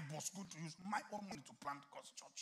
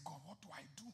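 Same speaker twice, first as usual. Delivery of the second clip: whispered dialogue; 8 dB quieter than usual. Retold or imitated. Retold whispered exchange with God.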